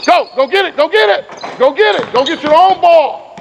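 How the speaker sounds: high, urgent coaching calls; calling out commands. Real, not acted. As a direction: spur the players on from the sideline. Coach shouting drill commands.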